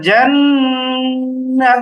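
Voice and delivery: জান with the n held long in the nose; জান না, a long hum between syllables; ghunnah. Shown as a demonstration. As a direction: draw out the nasal n of জান, then release না. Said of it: "ওয়াজিব গুন্না করতে হবে".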